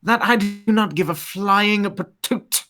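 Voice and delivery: playful, exaggerated British accent. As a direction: put on a mock-genteel British voice, savouring each word. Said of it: "do your British accent".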